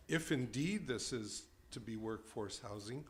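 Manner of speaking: ordinary talk, nothing marked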